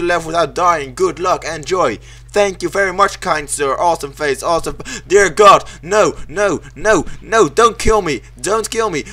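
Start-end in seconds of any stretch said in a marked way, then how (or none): none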